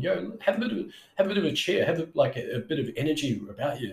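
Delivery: bright upward inflection, pitched high; upbeat rising tone. Shown as a demonstration. Sales coach shows cheerful rising tone to clients. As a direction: lift the voice up with cheer. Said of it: "start inflecting your words up like that".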